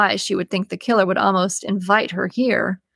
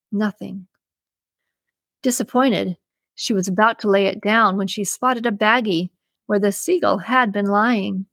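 ordinary delivery, nothing marked

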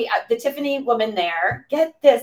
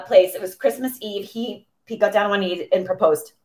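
normal talking voice

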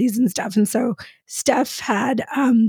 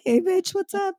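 mock greeting; 7 semitones higher, 3 dB quieter than usual. Mocking friend's text greeting.

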